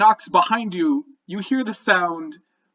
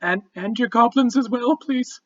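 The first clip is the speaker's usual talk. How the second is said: squeaky goblin voice; 9 semitones higher than usual. Acted. Goblin adds a request.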